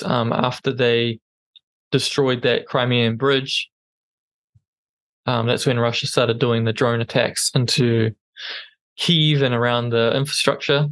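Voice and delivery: usual speaking voice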